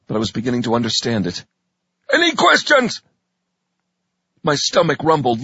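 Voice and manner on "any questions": high, loud barking drill sergeant. Acted. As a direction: bark it at the recruits. Drill sergeant addressing recruits.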